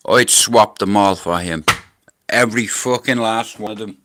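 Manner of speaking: loud, forceful vow; emphatic declaration. Real, not acted. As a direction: declare it at full volume, meaning every word. Emphatic vow about the lost mates.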